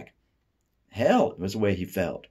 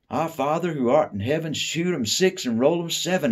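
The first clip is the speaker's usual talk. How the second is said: high, loud giggly mock prayer; giggling mock prayer; 10 semitones higher, 6 dB louder than usual. Boy giggles while twisting a prayer, high and loud. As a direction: chant the prayer as a naughty joke, giggling. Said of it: "he said, giggling again".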